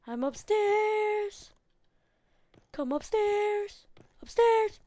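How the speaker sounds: high, slow sung-out calls; yelling across the room. Real, not acted. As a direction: belt it out operatically to lure him. Calling monster from upstairs.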